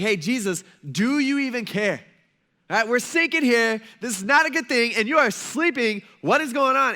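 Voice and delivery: loud, high-pitched freaked-out complaint; panicked disciples. Acted. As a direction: confront him in a rising mock panic. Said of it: "they're freaking out so much".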